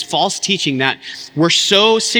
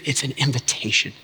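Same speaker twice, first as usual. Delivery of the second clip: soft emphasis; 5 semitones lower, 6 dB quieter than usual. Real, not acted. Softened key point in sermon.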